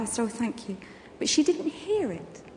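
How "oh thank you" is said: softer, muttered thanks; under my breath. Retold or imitated. Pregnant woman thanking a lady at the door, under her breath. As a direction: barely say it to yourself. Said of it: "but quite under my breath".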